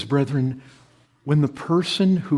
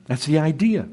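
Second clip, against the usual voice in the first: ordinary talk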